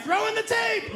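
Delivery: high-pitched eager call; excited call. Acted. Man in a bad mood calls for his favorite tape, high excited call. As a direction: call it out high, like demanding a treat.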